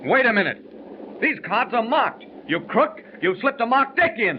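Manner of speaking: high, heated outburst at the cheat; angry accusation. Acted. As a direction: call him a crook with rising fury. Accuses dealer of cheating at cards.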